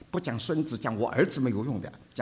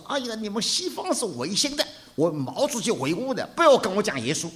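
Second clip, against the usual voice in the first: scoffing father; 9 semitones higher, 4 dB louder than usual. Retold or imitated. Father voiced rejecting gospel.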